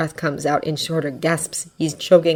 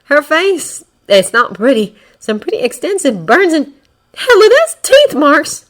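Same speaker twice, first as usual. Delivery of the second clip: a touch shaken, higher and louder; almost shaken; 10 semitones higher, 8 dB louder than usual. A narrator's reading. Magna reporting the boy's burns, almost shaken. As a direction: voice Magna rattled by the injuries. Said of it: "She sounds almost shaken".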